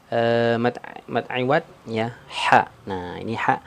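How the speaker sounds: ordinary talk, nothing marked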